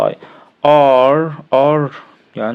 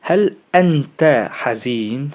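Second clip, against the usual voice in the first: slow question; much slower than usual. Shown as a demonstration. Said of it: "إنما لما تيجي هنا بقى".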